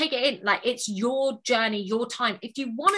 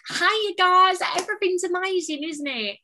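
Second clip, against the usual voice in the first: Essex accent; 8 semitones higher than usual. Shown as a demonstration. Showing the host how friendly it is, Essex accent. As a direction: open high and gushing in Essex, then ease down. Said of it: "I love the Essex accent".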